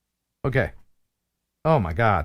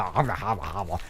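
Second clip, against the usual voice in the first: low, quiet gobbling noise; mock gobbling; 11 semitones lower, 9 dB quieter than usual. Host admits eating all the candy, mock gobbling. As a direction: mumble it like stuffing your mouth.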